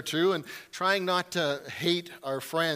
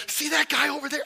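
mock nervous question; 8 semitones higher than usual. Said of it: "And I'm like".

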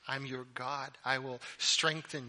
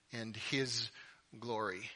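ordinary talk

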